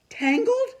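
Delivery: high, loud shocked yell; a girl shocked that the bird is tangled. Acted. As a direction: shriek the word in disbelief. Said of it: "I yelled".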